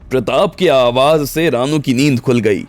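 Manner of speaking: ordinary talk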